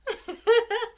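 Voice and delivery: helpless laughter; laughing hard. Real, not acted. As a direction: sing it out while cracking up. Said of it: "I don't know why I'm laughing".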